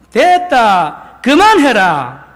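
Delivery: very slow, loud Busan-dialect rebuke; Busan dialect scolding. Pretend. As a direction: drag out a stern Gyeongsang-dialect order to stop. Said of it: "부산 사람 예수님이었다면".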